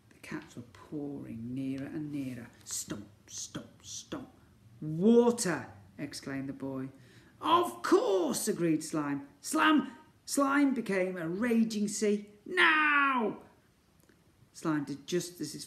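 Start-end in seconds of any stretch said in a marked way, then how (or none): 0.1-4.3 hushed, creeping whisper; whispered suspense. Acted. Cats creeping nearer. 4.8-13.4 high, loud eager cries; excited discovery. Acted. Rescue idea and action.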